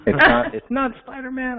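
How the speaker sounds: high, loud disbelieving echo; surprised. Real, not acted. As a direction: repeat it in shock. Incredulous echo of mask news.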